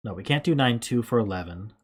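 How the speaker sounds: usual speaking voice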